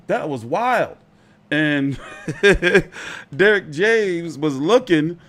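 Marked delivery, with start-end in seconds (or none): 2.0-3.3 amused chuckling; a short, loud run of chuckles. Real, not acted. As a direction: chuckle at the memory. Amused by the knockout memory.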